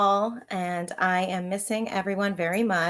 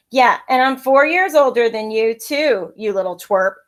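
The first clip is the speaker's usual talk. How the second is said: louder taunting call; called after; 3 semitones higher, 12 dB louder than usual. Acted. Brother calling a taunt after his sister, loud. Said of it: "he called after me".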